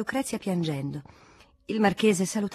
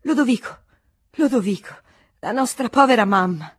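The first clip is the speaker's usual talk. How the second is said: louder, higher anguished cry of the name; bursting out in grief; 3 semitones higher, 8 dB louder than usual. Acted. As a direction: cry out to the brother, breaking down. (la marchesa in ginocchio prorompe disperata)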